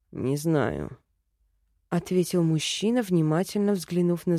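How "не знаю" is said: low, soft man's voice; elf man voice. A narrator's reading. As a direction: answer low and thoughtful. Elf character voice. (низкий задумчивый голос эльфа)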